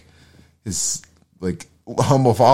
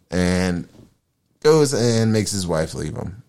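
ordinary talk, nothing marked

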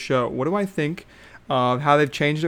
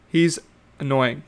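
ordinary talk

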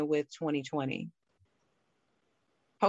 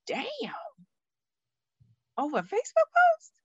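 incredulous disbelief; faster than usual. Retold or imitated. Remembering the breakup over a post, stunned disbelief. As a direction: voice jumps high in disbelief at "Facebook post". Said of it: "And I'm like".

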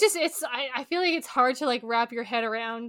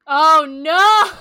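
a louder, laughing-in-horror oh no; loud dismayed cry; 4 semitones higher, 13 dB louder than usual. Real, not acted. Friend cries out on hearing about the Scottish accent.

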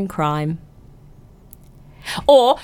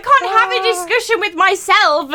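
indignant; 11 semitones higher than usual. Real, not acted. Complaining partner ignores her.

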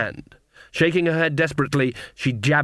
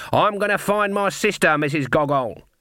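Granny raising her voice, higher pitched; raised voice; 6 semitones higher than usual. A narrator's reading. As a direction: speak up firmly so the hall hears. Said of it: "raising her voice again".

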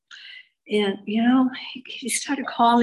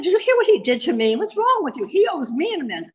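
high-pitched, loud mock outrage; retold indignant protest, pitch swooping high; indignant outburst; 8 semitones higher, 3 dB louder than usual. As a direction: act out an incredulous, offended refusal. Acted outraged reply to sponsor.

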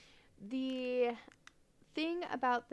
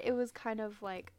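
normal talking voice

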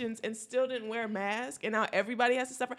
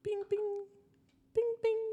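high chime ding sung out; high bing bing chime; chime imitation; 9 semitones higher than usual. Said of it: "like a pilot voice".

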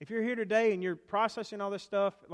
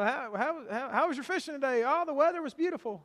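higher voiced, chatty bystander asking about the catch; mock small talk; 7 semitones higher than usual. Someone on the shore asks the fishermen how fishing went, pitched up. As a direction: lightly play a nosy neighbour, pitched up.

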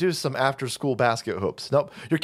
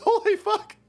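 laughing in disbelief. Real, not acted. Laughing at the migrant camp footage.

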